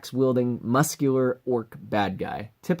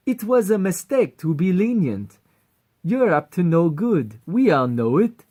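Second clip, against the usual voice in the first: put-on higher, louder vain Fabio accent; 6 semitones higher, 5 dB louder than usual. Vain general warning the heroes in a Fabio accent. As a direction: strut through the threat in a Fabio accent. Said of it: "Fabio appearance and accent".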